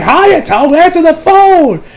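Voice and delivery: high, sing-out yell to her son; mom calling out. Retold or imitated. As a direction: call across the house, stretching it.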